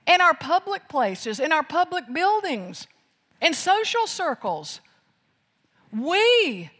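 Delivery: high, loud preaching at full force; impassioned sermon cry; fiery preaching. Acted. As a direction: thunder it from the pulpit.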